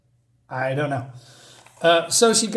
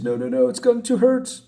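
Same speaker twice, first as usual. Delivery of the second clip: higher, panicked plea; panicked pleading; 7 semitones higher than usual. Retold or imitated. Thug begs the killer woman not to hurt him, panicked pleading. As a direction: beg higher and faster, scared. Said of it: "And he says".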